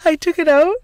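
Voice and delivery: high, loud theatrical retake; artistic retake. Shown as a demonstration. Storyteller being artistic about taking the trash out, theatrical high voice. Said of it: "Let your bestie be artistic".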